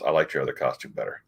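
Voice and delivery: low, soft deadpan jab; Wolverine. Retold or imitated. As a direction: deliver the acted comeback low and quiet. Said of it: "and Wolverine goes".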